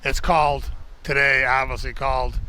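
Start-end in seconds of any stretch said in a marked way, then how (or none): none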